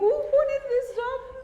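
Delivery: high, slow mock indignation; mock outrage. Real, not acted. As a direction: demand it in mock shock. Mock outrage at erased card.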